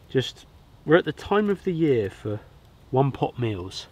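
usual speaking voice

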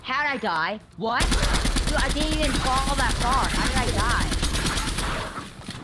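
high, stunned outcry; can't believe he died; shocked disbelief. Real, not acted. Dies suddenly in game.

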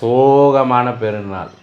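"sad festival" drawn out slowly; mournful drawl. Real, not acted. Vlogger telling viewers about their lonely Eid, slow and mournful. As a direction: stretch the words out for mock-tragic weight.

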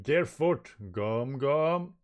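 lightly acted attack call; Luffy. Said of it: "And Luffy going".

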